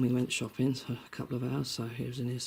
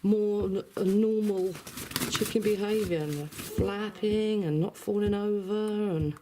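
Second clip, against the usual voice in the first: high sing-song chatter to the hen; pet talk voice; 6 semitones higher than usual. Real, not acted. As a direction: chat to your chicken, pitched up. Talking to pet chicken.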